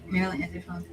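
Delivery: ordinary talk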